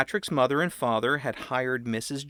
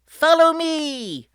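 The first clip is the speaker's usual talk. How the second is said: high, loud and slow showman voice; grand welcome; 6 dB louder, slower than usual. A narrator's reading. Patrick presenting the bubble room, slow and grand. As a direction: draw it out like a ringmaster. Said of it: "Patrick said".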